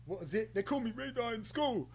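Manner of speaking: high mock voice, hesitant then boastful; mocking impression. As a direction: mimic him fumbling, then boasting. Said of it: "That's what I hear".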